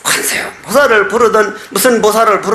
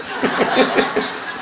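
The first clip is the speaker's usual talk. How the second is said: breathy laugh; 2 semitones higher, 7 dB quieter than usual. Real, not acted. Laughs at own punchline.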